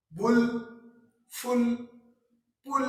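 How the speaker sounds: "बुल", "फुल", "पुल" each said loud and clear; word demo. Shown as a demonstration. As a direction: punch out each example word. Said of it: "तो ये जो है". ("बुल, फुल, पुल" ज़ोर से, हर शब्द अलग)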